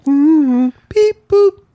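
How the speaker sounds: squeaky toy robot beeps. Retold or imitated. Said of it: "It's like, Lord of the Rings".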